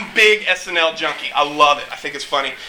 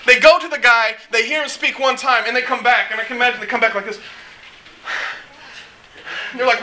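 ordinary delivery

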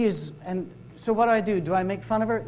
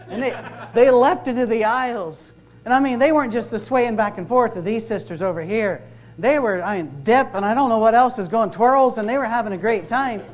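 louder, amused building retelling; excited; 4 semitones higher, 7 dB louder than usual. Real, not acted. Preacher describing visitors leaping in the aisles, gleeful and loud. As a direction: tell it with rising glee and laughter.

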